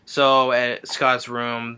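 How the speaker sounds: ordinary talk, nothing marked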